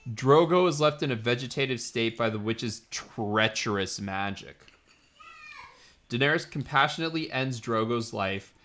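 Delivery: usual speaking voice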